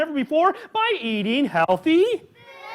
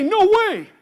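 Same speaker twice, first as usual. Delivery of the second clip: put-on loud, high call for the kids to echo; loud refusal; 8 semitones higher, 5 dB louder than usual. Shown as a demonstration. Host cueing kids to shout the line, loud. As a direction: lead the crowd in a big no. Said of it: "Everybody say".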